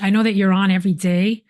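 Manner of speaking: ordinary delivery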